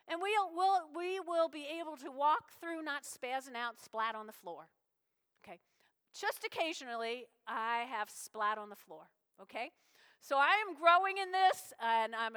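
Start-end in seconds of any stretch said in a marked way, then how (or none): none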